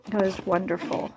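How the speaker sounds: normal talking voice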